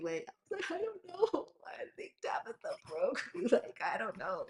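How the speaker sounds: laughing through the words; the yoga therapist story, laughing in disbelief at herself. Real, not acted. Real giggles breaking through.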